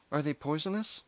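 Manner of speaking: voiced worried mother. A narrator's reading. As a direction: ask it high, as an anxious mother. Retake in mother's higher voice.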